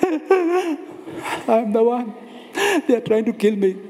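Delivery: hammed-up high tearful wail; mock crying. Elijah pleading that they want to kill him, fake sobbing. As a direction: sob it out with crocodile tears. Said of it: "he's pouring crocodile tears".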